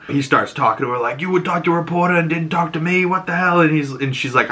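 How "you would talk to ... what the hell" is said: high, fast mock outrage; indignant confrontation. Father confronting daughter over the reporter, indignant. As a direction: imitate a betrayed father demanding answers.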